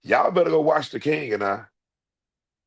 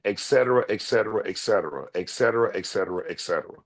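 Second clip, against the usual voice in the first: rattling faster and softer to a mumble; fading king's et cetera; 7 semitones lower, 3 dB quieter, faster than usual. Retold or imitated. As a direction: trail off royally. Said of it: "Everything dealing with lethal".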